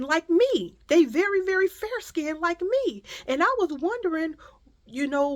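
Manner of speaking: high, stammering, careful voice; imitated nosy woman. Woman explaining skin-tone question to a mother, imitated hesitantly. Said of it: "She said".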